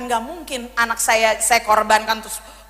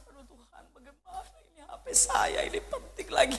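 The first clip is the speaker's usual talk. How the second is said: soft, breaking plea to God; half-laughing retelling of a weepy prayer; tearful prayer; 4 semitones higher, 8 dB quieter than usual. Retold or imitated. Mother who lost her phone cries softly to God. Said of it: "Sampai saya nangis".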